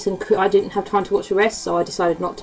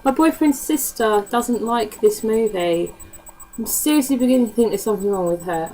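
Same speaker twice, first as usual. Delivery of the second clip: normal talking voice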